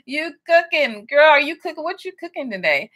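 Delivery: put-on trying a Bajan accent. Greeting Bajan Treasures in the live chat, playful mock Bajan accent. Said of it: "I gotta get that accent".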